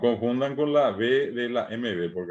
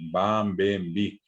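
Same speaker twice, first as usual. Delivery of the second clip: normal talking voice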